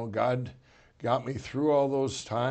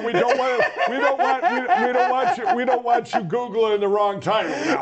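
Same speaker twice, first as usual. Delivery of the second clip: high, loud, through laughter; laughing correction; 9 semitones higher, 6 dB louder than usual. Real, not acted. Author ribbing host over wrong book title, laughing hard. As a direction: tease him while cracking up.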